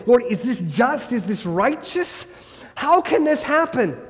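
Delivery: rising, pleading questions to God; a believer's distressed prayer, building to a high cry; anguished plea. Retold or imitated. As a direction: cry out to the Lord, voice climbing high.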